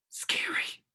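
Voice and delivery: breathy scared whisper. Acted. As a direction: whisper the fear out. Admitting fear of opening letters.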